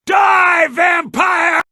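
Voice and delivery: high loud war shout; battle cry. Acted. Fighter attacks a vampire, shouting.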